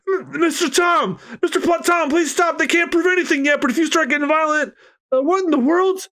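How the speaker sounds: high, frantic begging voice; shocked shipwright pleading with Tom; pleading onlooker. Acted. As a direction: beg Tom to stop in a high panic.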